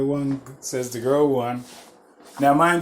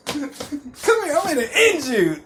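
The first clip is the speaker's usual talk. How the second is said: laughing threat; 11 semitones higher than usual. Retold or imitated. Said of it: "She said".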